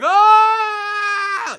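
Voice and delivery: long, high, loud scream; scream while flung skyward. Acted. Game master voices the guy launched skyward, screaming. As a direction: scream as you're blasted into the air. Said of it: "goes flying up into the air like".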